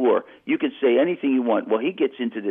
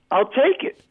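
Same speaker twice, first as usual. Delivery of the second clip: relieved; 12 semitones higher than usual. Retold or imitated. Relieved to get the call.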